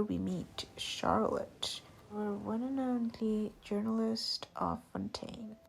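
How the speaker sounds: ordinary talk, nothing marked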